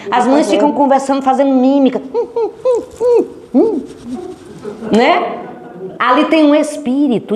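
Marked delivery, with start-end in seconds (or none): 2.1-3.8 high, tight-lipped hum hum; mock secretive humming; mimed humming. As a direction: hum like mothers signalling in secret. Mothers miming, humming signals.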